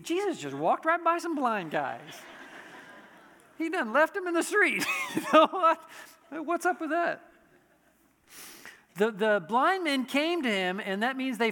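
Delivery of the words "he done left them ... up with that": chuckling through the words, pitch up; amused disbelief; laughing. Real, not acted. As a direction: laugh as you say it. Laughing at his own story.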